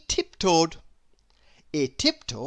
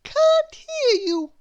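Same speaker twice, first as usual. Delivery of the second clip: high, polite mock-puzzled baker; feigned deafness; 5 dB louder, slower than usual. A narrator's reading. Baker feigning deafness to Mister Noisy, high and polite. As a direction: pretend you can't hear him. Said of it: "replied Missus Crumb".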